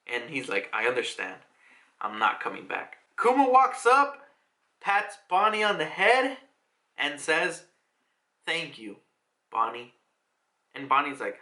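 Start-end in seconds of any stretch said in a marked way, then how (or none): 0.7-3.0 low, hushed man's voice; subdued resignation. Acted. Kuma accepts a farewell. 8.5-9.9 low, soft father-like voice; tender thanks. Acted. Kuma gently thanks Bonnie.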